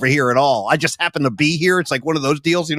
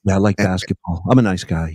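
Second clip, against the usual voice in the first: much lower, mild, gentle mumble; soft-spoken Dylan Brooks; 10 semitones lower than usual. Retold or imitated. Host imitating Dylan Brooks's soft off-court voice, low and gentle. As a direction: imitate a shy, quiet giant off the court. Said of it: "he talks like this".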